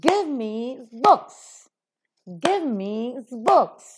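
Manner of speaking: "Give" and "books" hit loud and high; loud stressed demo. Shown as a demonstration. Teacher drills the class loudly on "Give" and "books". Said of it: "books being noun is accented".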